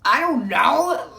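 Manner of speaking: high, slow, loud little panicked voice; mock panicked squeak. Caught off guard by the officer, slow high panicked squeak. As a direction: stretch out a high, lost I don't know.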